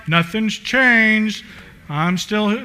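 very slow, drawn-out mock complacency; mock nonchalance. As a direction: stretch it out, mimicking someone oblivious. Mimicking an unchanged churchgoer.

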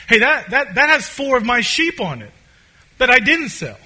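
loud, high cry of sudden recognition; excited shepherd. Retold or imitated. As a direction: call out as you spot your own sheep. Shepherd spots his sheep on train.

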